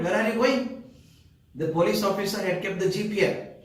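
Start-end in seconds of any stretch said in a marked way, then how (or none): 0.0-0.7 high, loud demanding call; shouted question. Retold or imitated. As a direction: call out sharply to stop them. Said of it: "the station master shouted".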